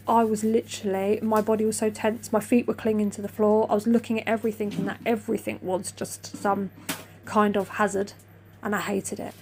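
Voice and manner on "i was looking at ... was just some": usual speaking voice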